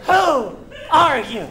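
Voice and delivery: Who are you? blurted out loud and slow, with a laugh; a loud, laughing, exasperated outburst. Pretend. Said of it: "then you say it out loud".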